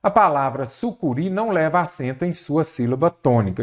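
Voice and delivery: usual speaking voice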